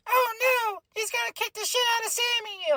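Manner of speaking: put-on high, squeaky baby voice. Daughter mimicking a baby while watching Roman. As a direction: coo it like a little kid. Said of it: "like, in his baby voice".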